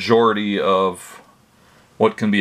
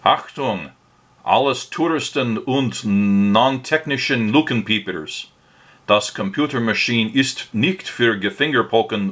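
hammed-up fake German accent, pitched higher; 4 semitones higher than usual. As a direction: read the sign as a mock-stern German warning in a fake German accent. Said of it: "my best fake German accent".

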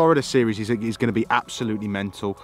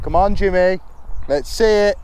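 excited cheering; 9 semitones higher, 8 dB louder than usual. Real, not acted. Cheering on friend's catch.

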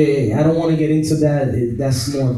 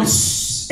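sharp hushing sound; imitated shush; 5 dB quieter than usual. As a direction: cut her off with a shush. Shushes the scolding wife.

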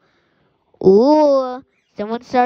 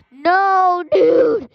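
high, louder cry of protest; pained whine; 7 semitones higher, 4 dB louder than usual. Real, not acted. Gamer hit by another player, whiny cry. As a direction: wail at being hit. Gamer gets shot out of nowhere.

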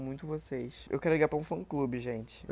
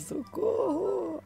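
high, drawn-out fake cry for help; mock fan wail; much slower than usual. Voicing a fan's comment, a stretched high wail.

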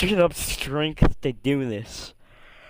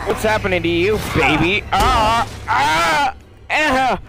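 high panicked yelps; panicked shrieks; 6 semitones higher, slower than usual. Real, not acted. Player panics as the witch Mia attacks, shrieking. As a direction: shriek in real fright.

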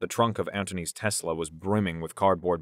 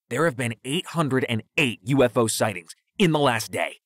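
high, urgent conspiracy theorist; hyper conspiracy rant; 5 semitones higher, 4 dB louder than usual. A narrator's reading. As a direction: push the theory with excited insistence. Pushing ahead with conspiracy theory.

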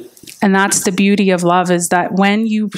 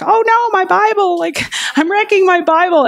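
very high, tearful little panic; retold tearful dismay, pitched high; teary panic; 10 semitones higher than usual. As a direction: squeak it out through tears over the Bible. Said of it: "I'm, like, crying".

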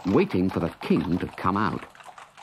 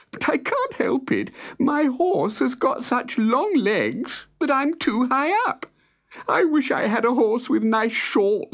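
tearful lament; 13 semitones higher than usual. Acted. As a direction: whine it through tears, much higher. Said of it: "sobbed the soldier".

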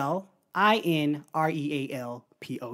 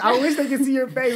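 usual speaking voice

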